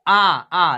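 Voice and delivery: आ, आ drawn out very slowly as a model vowel; slow vowel demo. Shown as a demonstration. Teacher slowly models the vowel of आँख for pupils. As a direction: stretch आ, आ slowly, showing the open vowel. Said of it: "तो इसको हम लोग पढ़ेंगे आँख".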